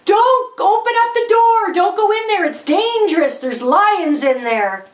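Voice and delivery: screamed warning. Acted. Screaming at boy by the lion's pen, urgent high yell. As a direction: cry out a panicky warning. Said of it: "and I screamed".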